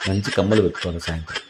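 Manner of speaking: soft, low private musing; hushed comic aside; musing to oneself. Acted. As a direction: murmur it low, lost in thought. Doctor muses to himself about earrings.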